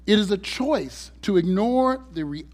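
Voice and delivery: ordinary talk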